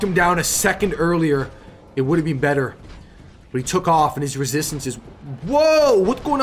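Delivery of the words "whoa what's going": sudden high startled whoa; surprised whoa. Real, not acted. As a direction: blurt it out in shock.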